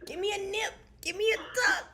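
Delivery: high, loud, joking demand; playful excited demand. Pretend. Friend joking about a California tummy tuck, excited demand. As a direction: call it out high and punchy. Jokes about getting surgery extras.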